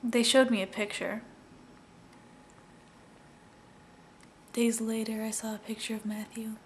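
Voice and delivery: understated sad monologue of a police officer; halting testimony with long, heavy pauses; Reggie Flutie. Acted. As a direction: recount a grim discovery, subdued, pausing between phrases. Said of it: "I wanted to do a sad monologue".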